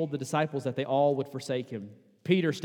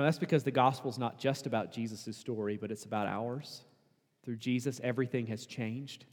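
normal talking voice

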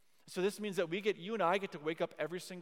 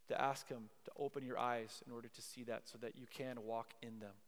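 low, soft prayer prompts; hushed pastoral guidance, leaving room to pray; guided prayer; 4 semitones lower, 8 dB quieter than usual. Real, not acted. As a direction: quietly lead them into personal reflection. Guides congregation in silent prayer.

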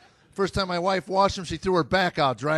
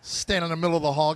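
usual speaking voice

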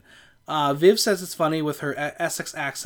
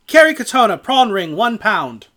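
said again, louder and clearer; louder repeat; 5 semitones higher, 9 dB louder than usual. Real, not acted. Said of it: "Say that again?".

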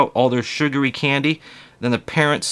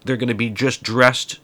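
usual speaking voice

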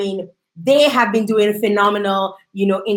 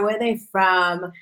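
ordinary talk

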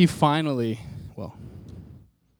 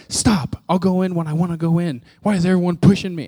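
higher, louder retold irritation; mock snapping; 4 semitones higher, 5 dB louder than usual. Snapped at people urging them to go in, irritated. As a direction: act out snapping at someone pushing you. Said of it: "I snapped at him".

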